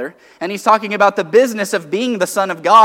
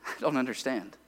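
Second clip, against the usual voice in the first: confused; 5 semitones lower, 13 dB quieter than usual. Retold or imitated. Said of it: "they go".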